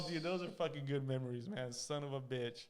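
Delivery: ordinary delivery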